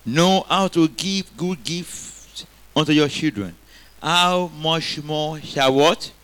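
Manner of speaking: slow, weighty reading of the verse; slow scripture reading. Shown as a demonstration. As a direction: draw the verse out slowly.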